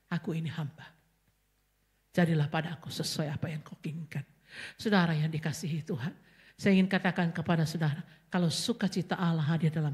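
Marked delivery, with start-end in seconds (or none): 0.1-4.2 soft surrender. Acted. Mary voiced accepting God's will.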